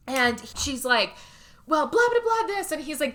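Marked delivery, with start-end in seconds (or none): 1.7-2.7 high, loud mock bickering; arguing. Wife arguing back in the bedroom, imitated bickering. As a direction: imitate a worked-up spouse squabbling. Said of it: "they're arguing for a minute".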